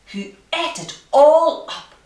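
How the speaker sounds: ordinary delivery, nothing marked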